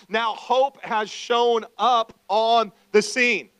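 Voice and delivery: loud, high preacher voice, often slow; full-force preaching. Acted. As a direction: preach it out to the whole church.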